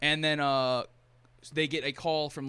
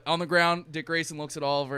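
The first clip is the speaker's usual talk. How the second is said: ordinary talk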